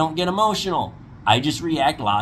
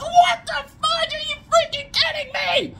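shocked shriek. Retold or imitated. Reliving near-death collapse in test.

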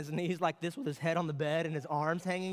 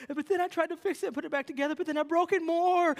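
put-on high, fast, louder little boy's plea; upset child voice; 13 semitones higher, 8 dB louder than usual. Son confessing he broke it worse, high and fast. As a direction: rush it out like a guilty kid. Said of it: "he's like".

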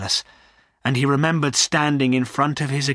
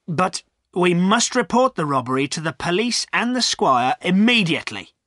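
higher-pitched, emphatic farrier; decisive farrier; 6 semitones higher, 3 dB louder than usual. A narrator's reading. Farrier urging the men to report it, decisive. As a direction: urge action at once.

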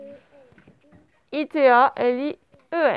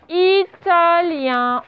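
very slow, raised, each syllable held; teacher's drawn-out dictation, pitched up; 4 semitones higher, much slower than usual. Shown as a demonstration. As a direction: dictate it syllable by syllable, stretching the vowels. Dictating a word to pupils.

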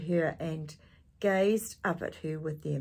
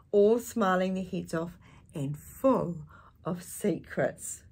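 ordinary delivery

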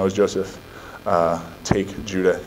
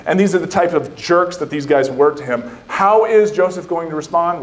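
preaching at full force; 7 semitones higher than usual. Acted. Pastor stirring the church with full-force preaching. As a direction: drive the point home to the congregation.

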